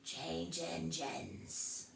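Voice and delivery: very slow, hoarse horse voice. A narrator's reading. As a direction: croak it, then choke. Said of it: "a hoarse voice spoke next".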